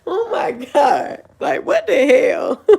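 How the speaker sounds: high, slow, breathless laughing fits between words; crying with laughter, gasping out oh my God; helpless laughter. Real, not acted. Laughing at the rival's accusation to her viewers, helpless laughter.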